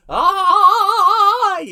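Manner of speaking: high, loud imitated shriek "Ah!"; mock shriek. Vlogger mocks the clip's shriek, loud and high. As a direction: shriek it like the overexcited clip. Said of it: "I'm getting".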